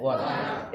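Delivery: wanna said as one blended word; soft wanna demo. Shown as a demonstration. Teacher models short form for students, soft blended wanna. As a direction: drop into a soft spoken wanna. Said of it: "हम कहते हैं wanna".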